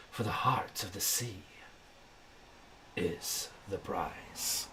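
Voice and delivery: slow, hushed ominous verse; poem recital. Acted. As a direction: recite it like a dark sea legend.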